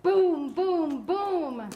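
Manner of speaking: loud, separate booms, each falling; booming thunder. A narrator's reading. Teacher performs Mister Brown's thunder for kids, loud booming. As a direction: boom it out like thunderclaps. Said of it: "Mister Brown makes thunder".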